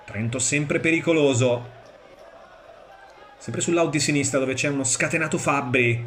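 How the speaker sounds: ordinary delivery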